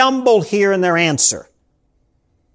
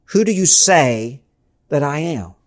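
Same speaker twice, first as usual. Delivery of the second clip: weighty slow question; 6 semitones lower than usual. Acted. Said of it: "take on the weight here of Jesus' question".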